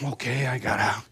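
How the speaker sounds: re-enacted soft, low giving in; meek resignation. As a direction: back down quietly, voice dropped. Gives in after being kicked out.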